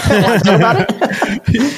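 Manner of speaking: playful laughing offer; laughing suggestion. Shown as a demonstration. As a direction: offer it with a giggle. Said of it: "What you could try to do is say".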